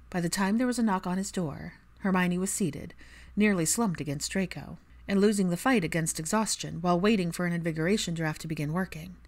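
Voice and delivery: plain reading voice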